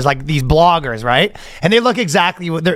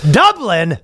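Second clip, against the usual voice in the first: high, drawn-out mock Irish lament; hammed-up Irish, wailing high; bad Irish accent; 5 semitones higher, much slower than usual. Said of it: "That's a bad Irish accent".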